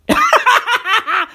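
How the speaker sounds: high rapid cackle at own joke; quick stuttering giggle; laugh. Real, not acted.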